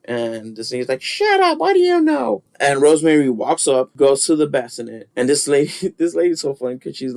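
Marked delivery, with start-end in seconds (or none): 1.0-2.4 very high, squawky put-on retort; mock indignant retort. Coven lady answering Rosemary about rocking the baby, mock indignant. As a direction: snap back in a high mocking voice.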